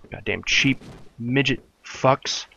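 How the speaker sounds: ordinary delivery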